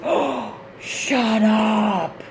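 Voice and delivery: imitating sister. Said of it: "she says".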